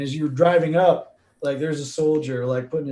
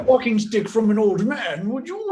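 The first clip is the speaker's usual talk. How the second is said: put-on old man plea; 6 semitones higher, 3 dB louder than usual. GM voices pleading old man.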